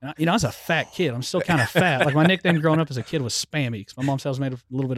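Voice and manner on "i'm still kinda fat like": normal talking voice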